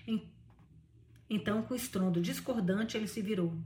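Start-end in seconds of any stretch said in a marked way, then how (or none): none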